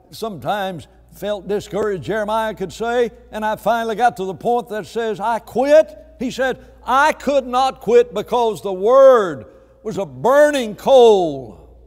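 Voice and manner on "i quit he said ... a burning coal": loud, high declaration falling on the last word; forceful preacher voicing a prophet's resolve; impassioned preaching. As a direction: build to a full-force pulpit climax.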